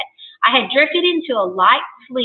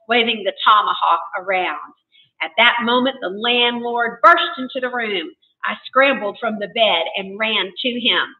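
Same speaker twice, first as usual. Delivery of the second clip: plain reading voice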